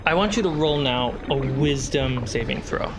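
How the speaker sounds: ordinary talk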